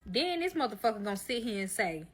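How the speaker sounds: normal talking voice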